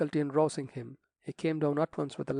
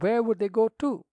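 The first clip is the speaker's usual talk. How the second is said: rising, louder protest; objecting; 7 semitones higher, 6 dB louder than usual. A narrator's reading. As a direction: object to the idea, voice climbing high. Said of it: "objected the rector".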